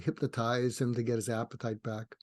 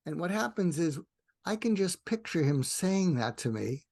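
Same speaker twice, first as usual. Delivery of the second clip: normal talking voice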